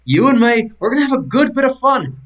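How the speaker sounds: excited innkeeper. Acted. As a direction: pitch it up with glee at a new brawling pal.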